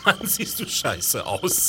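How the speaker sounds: slow, quiet, through laughter; chuckling while teasing; laughing. Real, not acted. Colleague laughing at costume.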